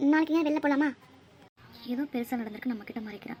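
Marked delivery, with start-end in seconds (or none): 1.9-3.4 low, soft suspicious musing; hushed thinking aloud, sinking lower; quiet aside. Acted. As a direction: murmur it to yourself. Mother muses aside to herself.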